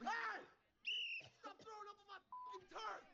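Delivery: high-pitched coach's holler; angry yelling. Acted. Coach scolds a kid being sick on turf, angry yell. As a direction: shout across the field in outrage.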